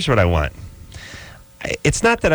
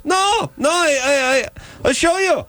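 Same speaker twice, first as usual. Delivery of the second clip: very high-pitched mock Israeli woman; Israeli neighbor accent. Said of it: "sixty-year-old Israeli".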